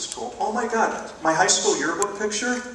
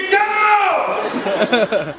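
higher, louder burst; mock yell; 10 semitones higher, 5 dB louder than usual. Driver at a green light, sudden yell. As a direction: explode at the stalled car.

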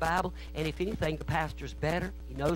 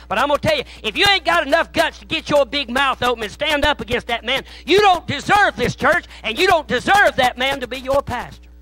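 higher, louder, slowing on the key lines; full-force preaching; 9 semitones higher, 11 dB louder than usual. Real, not acted. Preacher rebuking timid church members, full-force preaching. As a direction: hammer it home to the congregation.

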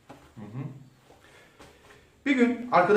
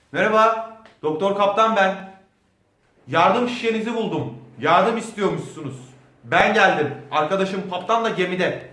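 loud calling out to the castle; 3 semitones higher, 8 dB louder than usual. Acted. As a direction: call out loudly, hoping someone answers. (şatoya seslenerek yüksek sesle)

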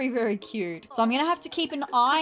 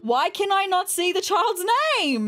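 ordinary delivery

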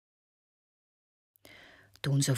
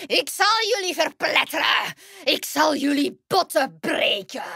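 hoog en luider dreigend heksengeschreeuw; dreigend krijsen; 10 semitones higher, 4 dB louder than usual. Acted. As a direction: krijs je dreigement van de rots. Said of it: "krijste de heks dreigend". (heks krijst hoog en luider haar dreigement)

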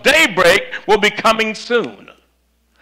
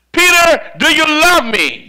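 Jesus asking Peter again, raised and urgent; insistent Jesus; 8 semitones higher than usual. Acted. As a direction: press the question harder. Jesus asks a second time.

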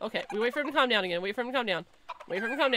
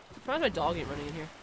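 ordinary talk

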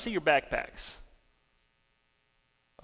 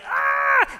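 high, loud excited yell; acted-out scream of excitement; 9 dB louder than usual. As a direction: shriek for joy as the prophet. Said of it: "he got so excited".

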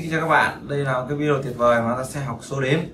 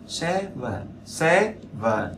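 "seven" high on SE, dropping on VEN; slow "seven", SE-ven; first-syllable stress; 3 semitones higher, 6 dB quieter, much slower than usual. Shown as a demonstration. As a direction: stress the start of "seven", let it fall. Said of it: "trọng âm nó là âm thứ nhất".

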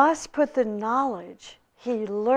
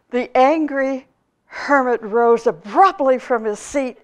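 normal talking voice

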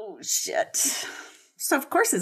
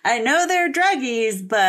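mock mom voice; 5 semitones higher, 4 dB louder, slower than usual. Voicing the mother mockingly.

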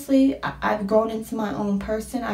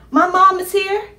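ordinary delivery

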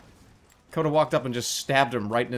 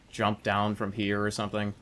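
usual speaking voice

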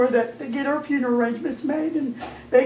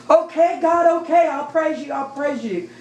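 retold crying out; 4 semitones higher than usual. Mother giving in to God, retold cry. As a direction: cry out, then let it fall. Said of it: "And I cried out".

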